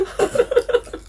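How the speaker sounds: short, high wheezy laugh. Real, not acted. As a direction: let a breathy giggle escape. Tertawa di tengah cerita. (tawa kecil melengking)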